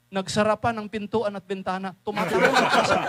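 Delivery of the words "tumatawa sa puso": talking through real laughter; chuckling preacher; laughing. Real, not acted. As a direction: let the laugh break the words. Laughing while describing joy.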